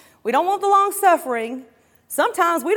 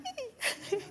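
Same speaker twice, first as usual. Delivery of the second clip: tiny high-pitched squeal of excitement; soft, squeaky giggle, acted out; excited squeal; 11 semitones higher, 13 dB quieter than usual. Preacher mimicking excitement with coworkers, high quiet squeal. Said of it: "I get all excited, and I'm like".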